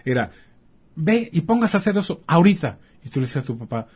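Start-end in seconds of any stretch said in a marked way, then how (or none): 0.9-2.8 higher, louder mock father's command; stern father order. Doctor imitating a strict father ordering his son, mock stern. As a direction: bark it like an old-school father, hammed-up.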